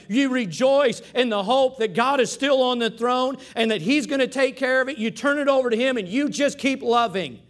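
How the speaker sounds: preaching at full force. Acted. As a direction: press the point home to the congregation.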